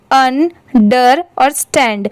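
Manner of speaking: "अन, डर और stand" as three separate pieces, pauses between; separated syllables. Shown as a demonstration. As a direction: break it up for learners: "अन", "डर", "stand". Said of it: "ये तीनों अलग-अलग लिखे रहे".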